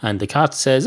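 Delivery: ordinary talk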